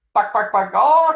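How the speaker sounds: high, loud chicken clucks; mock them like a clucking hen. Gang taunting cub, loud mocking chicken clucks. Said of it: "clucked the gang".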